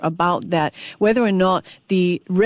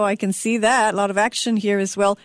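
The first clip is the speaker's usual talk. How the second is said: usual speaking voice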